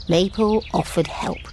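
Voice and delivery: plain reading voice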